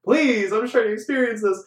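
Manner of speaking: high, loud retold complaint; exasperated outburst. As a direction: plead in mock outrage with noisy singers. Acted exasperation at people singing along.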